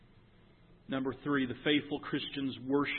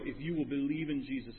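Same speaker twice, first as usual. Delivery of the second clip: ordinary talk, nothing marked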